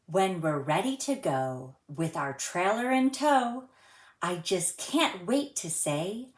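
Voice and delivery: gentle sing-song storybook reading; reading to children. A narrator's reading. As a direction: read it like a rhyme for little kids. Reads a rhyming picture book aloud.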